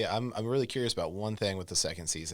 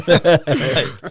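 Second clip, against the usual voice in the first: laughing; 9 semitones higher, 17 dB louder than usual. Real, not acted. Laughing at a failed Scottish impression of Sean Connery. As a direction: burst of loud high laughter.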